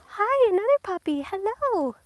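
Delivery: high-pitched hello to a puppy; puppy greeting. Real, not acted. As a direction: coo a delighted hello at a dog.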